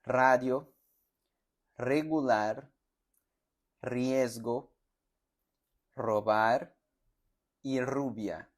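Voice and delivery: words with a strong rolled R at the start; R drill words; trilled R. Shown as a demonstration. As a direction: model "Radio" to "rubia" with a trill. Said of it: "Vamos a los ejemplos".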